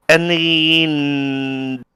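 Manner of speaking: ordinary talk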